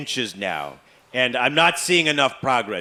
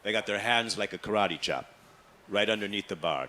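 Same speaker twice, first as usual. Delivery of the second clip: usual speaking voice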